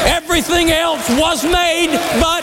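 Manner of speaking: high ringing shout at the peak; preaching shout. Real, not acted. Preacher shouting the climax to the congregation, high and full. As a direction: cry it out over the room.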